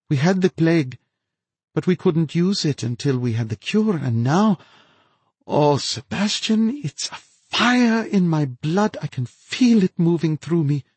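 gleeful mad scientist boasting; ecstatic mad scientist. A narrator's reading. Narrator voices the infected woman.

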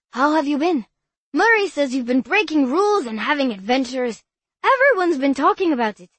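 put-on high, loud, enthusiastic young boy; bubbly boy who adores her; eager boy voice. Bobby's voice, held.

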